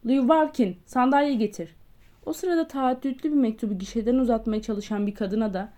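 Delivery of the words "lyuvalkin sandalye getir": loud, higher barked order; shouting. Acted. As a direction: yell across the post office. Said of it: "öyle bir bağırdı ki".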